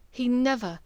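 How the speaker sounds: usual speaking voice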